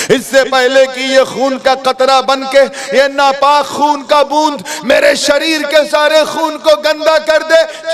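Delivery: high, loud, pleading; urgent frightened plea; desperate cry. Acted. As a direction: push the fear out loud. Youth's desperate inner speech.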